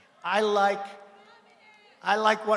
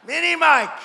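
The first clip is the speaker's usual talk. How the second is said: mocking shout; 7 semitones higher, 10 dB louder than usual. Mocking rally taunt.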